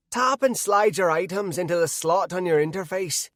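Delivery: high-pitched yet gravelly Irish voice; angry little leprechaun reciting instructions. A narrator's reading. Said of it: "in an Irish accent and high-pitched yet gravelly voice".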